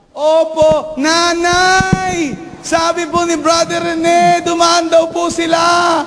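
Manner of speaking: high shout to mom in the back room; calling out loud. Retold or imitated. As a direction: yell it slowly through the house. Child shouting to mother inside. (mataas na sigaw ng bata sa nanay)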